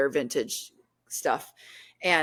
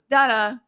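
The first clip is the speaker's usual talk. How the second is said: high, loud mock spirit box chirp; spirit box noise; 6 semitones higher, 6 dB louder than usual. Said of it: "it goes".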